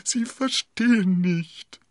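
slow, high, pleading landlord; theatrical whisper with voice in it; insistent stage whisper. A narrator's reading. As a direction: drag it out high and urgent. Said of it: "flüsterte er".

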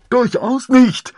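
very high, loud, slow protest; muffled under cloak. A narrator's reading. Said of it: "tönte es dumpf unter dem Mantel". (dumpf unterm Mantel hervor)

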